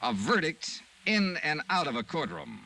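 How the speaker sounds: punchy teaser for the next episode; radio promo. Acted. Star teasing listeners about tomorrow's episode, promo read. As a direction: sell tomorrow's show with a hook.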